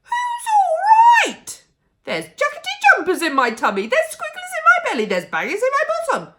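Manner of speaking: very high, loud fast ranting; grandma ranting at George about her tummy; outraged yelling. A narrator's reading. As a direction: rant about her belly in fury. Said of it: "she yelled".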